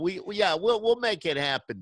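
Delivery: half-joking wise guy sealing the deal; mock mobster. Radio host answering the singer's Gambino joke as a playful mob boss. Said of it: "start calling you Gambino".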